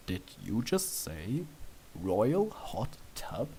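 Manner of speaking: low, slow, incredulous; stunned disbelief. A narrator's reading. You frozen after hearing of a royal hot tub, slow disbelief. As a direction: draw out the disbelief slowly.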